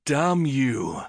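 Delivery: a touch of gruff annoyance; Syn's curse. Acted. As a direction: mutter it as the friend. Syn curses.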